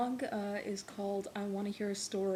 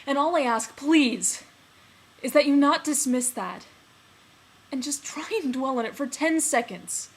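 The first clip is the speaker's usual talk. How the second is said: loud, raised-pitch desperate plea; impassioned pleading; 4 semitones higher, 12 dB louder than usual. Acted. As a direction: beg a loved one at full voice. Acted lover pleads not to be dismissed.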